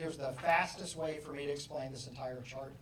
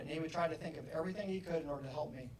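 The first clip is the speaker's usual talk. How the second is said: usual speaking voice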